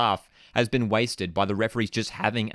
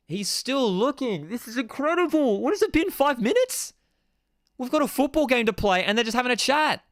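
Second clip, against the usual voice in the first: can't believe they're still talking; incredulous exasperation; 11 semitones higher than usual. Real, not acted. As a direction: voice shooting far higher in disbelief. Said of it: "I'm still freaking pissed".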